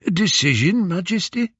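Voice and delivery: put-on high, deferential old beggar, rising in query; old man's questioning whine. Disguised Odysseus prompting Penelope to explain, old man's whine. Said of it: "old man's hoarse whine".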